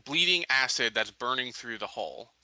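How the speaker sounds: normal talking voice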